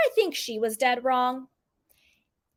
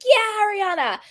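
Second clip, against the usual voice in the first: mock cheering; 10 semitones higher, 3 dB louder than usual. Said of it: "everybody was like".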